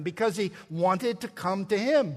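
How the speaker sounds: ordinary talk, nothing marked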